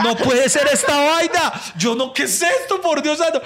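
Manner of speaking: high, drawn-out shocked exclamation; mock-horrified disbelief. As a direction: sound utterly stunned. Comedian shocked.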